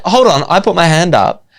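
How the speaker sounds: acted "wait a minute" protest; retold protest. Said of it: "And I was like".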